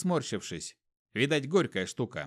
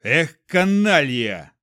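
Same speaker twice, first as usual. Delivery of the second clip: much louder, slow angry yell; a fat man shouting at a carter who nearly ran him over; 9 dB louder, much slower than usual. A narrator's reading. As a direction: yell it at the driver. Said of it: "крикнул он на возничего".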